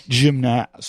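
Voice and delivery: gymnast as "jim-NAST"; amused mimicry of gymnast with a full "nast"; mocked pronunciation. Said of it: "the way he pronounces gymnast".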